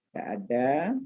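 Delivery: ordinary talk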